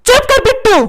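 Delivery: cross father silencing his son; scolding father. Acted. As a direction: shut the boy up, pitch jumping high.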